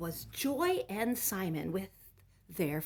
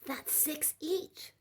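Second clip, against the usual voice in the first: put-on childish counting; 10 semitones higher, slower than usual. A narrator's reading.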